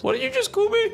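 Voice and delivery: very high, squeaky indignant question; mock offended squeak. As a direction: squeak it out, insulted. Offended reply to an insult meme.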